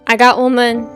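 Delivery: ordinary talk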